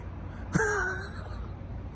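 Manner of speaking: high, breathless-sounding laugh; soft, high-pitched giggle. Real, not acted. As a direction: laugh at your own story. Laughing at his own story.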